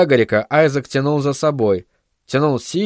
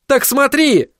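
high-pitched angry outburst; angry exclamation; 12 semitones higher, slower than usual. Acted. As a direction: throw it out as an angry command. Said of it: "зло воскликнул Айзек". (злой выкрик)

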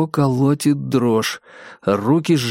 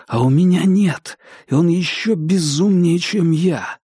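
higher, tense rising fear; panicky thought; 5 semitones higher than usual. Acted. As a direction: let the panic climb. Дейл в панике думает. (нарастающая паника)

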